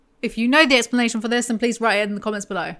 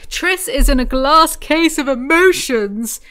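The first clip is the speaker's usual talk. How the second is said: loud, high, drawn-out announcement; mock-dramatic; 5 semitones higher, 7 dB louder, slower than usual. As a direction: belt it out slowly with mock grandeur. Mock-dramatic recap of the plot.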